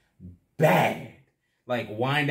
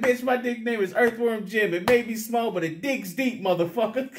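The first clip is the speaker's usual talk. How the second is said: cocky comeback; 10 semitones higher than usual. Retold or imitated. His own comeback, quoted.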